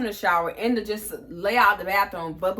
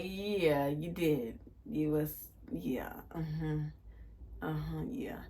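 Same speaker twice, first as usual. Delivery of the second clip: low, soft, knowing agreement; 6 semitones lower, 11 dB quieter, slower than usual. Retold or imitated. Friend agrees roommate had a night, retold knowing murmur. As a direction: humour her, low and under the voice.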